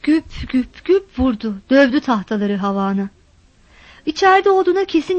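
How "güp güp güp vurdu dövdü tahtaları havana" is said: ordinary delivery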